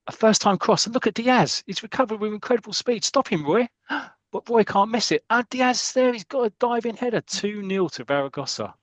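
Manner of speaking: high, urgent play-by-play; excited match commentary. Acted. Podcaster calling the diving header for listeners, excited commentary. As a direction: call the attack live, pitch held high until the score.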